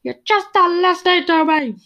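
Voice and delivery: high, strained, teary protest; mock anger-cry. To listeners, replaying her own angry-cry outburst, high and strained. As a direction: imitate crying while insisting you're not upset. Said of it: "Do you ever anger cry?".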